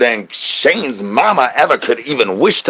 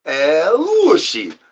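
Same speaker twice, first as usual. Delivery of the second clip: very slow, drawn-out strange voice; put-on voice; 3 semitones higher, much slower than usual. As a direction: stretch the name out in a silly character voice. Repeats the drawn-out call.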